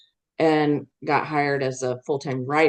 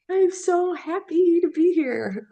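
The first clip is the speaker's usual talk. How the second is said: high, slow, dreamy delight; excited; slower than usual. Retold or imitated. Newcomer on State Street, gushing happily. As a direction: gush with wide-eyed joy. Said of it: "Like, I was really excited".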